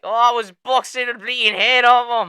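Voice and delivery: high, loud mock Irish accent; mock Irish Conor. Conor ranting to Joe Rogan after the leg break, mock Irish shout. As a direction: ham up Conor's Irish bluster. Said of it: "Conor's like".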